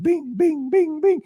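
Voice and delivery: very high, repeated bings; high rapid beep sounds; imitated beeps. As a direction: chirp them like scanner beeps.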